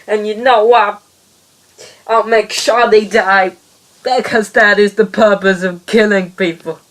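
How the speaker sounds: villain explaining his evil plan; hammed-up villain. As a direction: gloat like a pantomime baddie. Villain rant continues.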